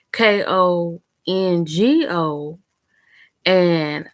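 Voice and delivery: ordinary talk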